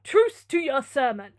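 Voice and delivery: loud, slow, lordly rebuke; impatient command. Acted. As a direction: silence the preacher with authority. Manfred cuts off the sermon.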